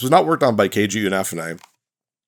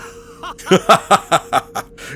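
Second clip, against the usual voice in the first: loud, high villain cackle; evil laugh; 10 semitones higher, 5 dB louder than usual. Acted. Villain reading his triumph.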